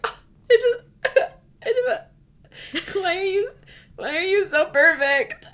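high, loud, laughing near-sob; overwhelmed fangirl wail. Acted. Reader swoons over her favorite character, tearfully overwhelmed. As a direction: gush as if it is too much to bear.

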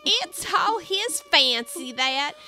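high raspy creature voice; raspy voice with eagle shrieks. Acted. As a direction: squawk it like a gruff griffin. Griffin talks to Alice.

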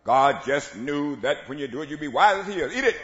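loud, insistent tempter; preacher's booming devil voice; devil shouting. Acted. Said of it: "then the devil says".

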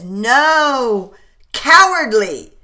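loud, high cry of protest; anguished shout. Acted. Hal crying out at the servant, loud high shout. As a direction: cry out to stop the shot.

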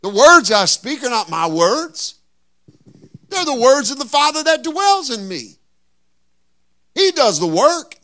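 loud, high, slow preaching shout; preaching at full force. Acted. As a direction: build it into a full preacher's cry.

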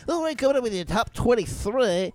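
mock radio DJ. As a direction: send up a cheesy radio DJ. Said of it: "even some of them guys are hokey".

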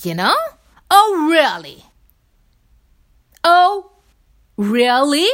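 high, loud, exaggerated "oh really"; mock surprise. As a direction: ham up a fake shocked reaction. Mock reaction to being corrected.

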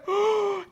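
sharp high imitated gasp; startled mom gasp; shocked gasp. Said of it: "And I'm like".

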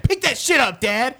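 put-on high, loud kid yelling; bratty shout. Imagined son yelling orders at his dad. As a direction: boss the dad around in a yell. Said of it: "he'd be like".

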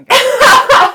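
high, loud, drawn-out exasperation; slow, loud embarrassed wail; loud exasperated groan. Retold or imitated. Embarrassed reaction to roast.

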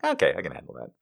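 low, soft, settled voice; calm down and reassure yourself; composed self-talk. Acted. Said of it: "once I know what they are".

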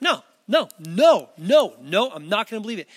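loud, repeated no's, then a quick dismissal; emphatic, disbelieving protest; stubborn refusal. Acted. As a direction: shut it down flat out, insistently. Acts out Thomas refusing the news.

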